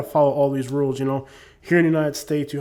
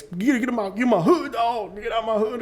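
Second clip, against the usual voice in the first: much higher, cartoonish voice ordering the cop off; mock street tough; 8 semitones higher than usual. Filming the cop and telling him off, in a much higher hammed-up voice. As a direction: shoo the cop away in a hammed-up voice.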